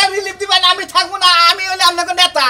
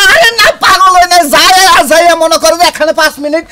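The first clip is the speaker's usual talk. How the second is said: much louder, insistent denial; loud protest; 8 dB louder than usual. Acted. Husband insisting to his wife he isn't mad, loud protest. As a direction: raise your voice to explain yourself.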